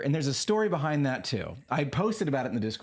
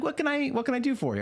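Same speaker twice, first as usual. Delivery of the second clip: chirpy AI receptionist; 12 semitones higher than usual. Retold or imitated. AI receptionist voiced.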